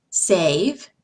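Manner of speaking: "save" with a long A, rising. Shown as a demonstration. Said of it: "A in save".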